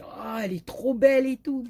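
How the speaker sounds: high, loud gushing delight. Retold or imitated. Admiring a card that was not hers, gushing. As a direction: gush over the pretty card. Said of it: "Je dis".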